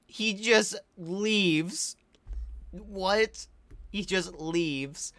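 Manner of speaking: slow, high, drawn-out disbelief; incredulous. Real, not acted. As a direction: stretch every word in amazed disbelief. Disbelief at the movie.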